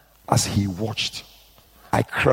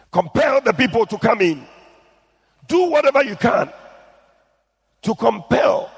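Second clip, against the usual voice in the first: preaching at full force; 9 semitones higher than usual. Real, not acted. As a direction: drive the word compel home. Preaching the command.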